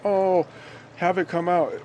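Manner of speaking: mock begging in a high voice; mock pleading. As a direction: ham up a desperate believer begging. Mocking people pleading to government.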